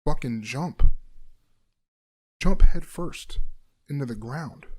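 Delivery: loud, drawn-out urging voice; menacing inner voice; intrusive inner voice. Retold or imitated. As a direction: voice the brain's sinister command. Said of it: "my brain goes".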